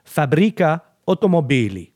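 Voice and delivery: lightly Italian-accented, rolled and sung out; mock Italian accent. Shown as a demonstration. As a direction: say the Italian name with a hammed-up Italian accent. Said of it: "je vais essayer de le dire avec, euh, mon accent, euh, italien, euh, catastrophique". (accent italien exagéré, pour rire)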